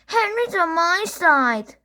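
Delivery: high whine telling on Henry; whiny little brother. Acted. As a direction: tattle to Dad in a high voice. Peter whining in the car.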